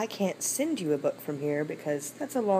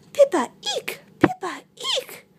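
very high tiny mouse squeak; squeaky mouse; 4 dB louder than usual. Acted. Mouse coming to help frog, very high squeak.

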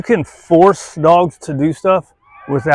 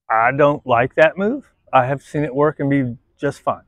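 usual speaking voice

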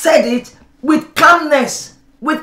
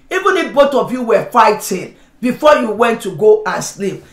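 ordinary talk

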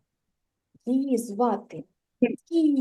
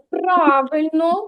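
ordinary talk, nothing marked

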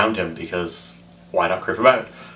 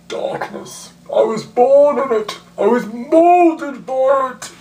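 high, loud, muffled, slow villain; Bane impression; 6 dB louder, slower than usual. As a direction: proclaim it through a mask. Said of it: "You have to muffle it a bit".

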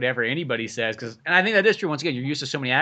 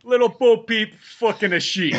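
higher, louder, drawn-out New York wise guy; hammed-up nursery-rhyme punchline; New York comic impression; 5 semitones higher, 4 dB louder, slower than usual. Riffing on gangster flicks, brash New York accent. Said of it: "a lot of Andrew Dice Clay".

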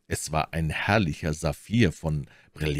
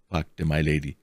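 plain reading voice

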